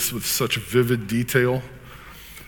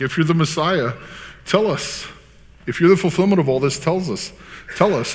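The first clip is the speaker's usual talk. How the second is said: high, louder, insistent questioning; pressing demand; 7 semitones higher, 5 dB louder than usual. Acted. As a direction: demand an answer, pitched well up. Council presses Jesus, acted paraphrase.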